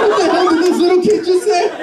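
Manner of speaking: high, mock-amused mobster outburst; mafia-style disbelief. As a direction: laugh it off in high-pitched incredulity. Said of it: "mafia when they can't believe".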